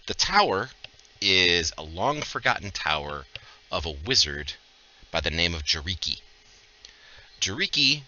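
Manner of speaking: measured storyteller with weighty pauses; game master narration. Acted. Game master briefing the hired mercenaries, measured scene-setting narration. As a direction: set the scene in deliberate, separated phrases.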